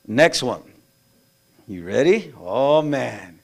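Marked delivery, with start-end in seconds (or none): none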